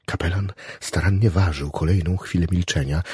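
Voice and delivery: ordinary delivery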